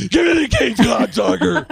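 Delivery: very high, loud hammed-up shout; over-the-top high bellow; Stephen Baldwin. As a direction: yell the lineup line at full tilt. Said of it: "But, but Stephen Baldwin".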